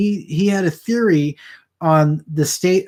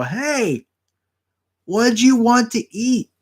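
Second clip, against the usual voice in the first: higher, slow call across six feet to a customer; calling out from afar; 8 semitones higher, slower than usual. Pretend. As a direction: stretch it out like calling a guest from a distance.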